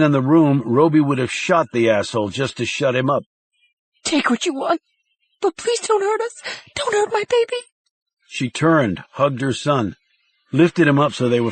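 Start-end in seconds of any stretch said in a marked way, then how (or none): 4.0-7.6 begging mother. A narrator's reading.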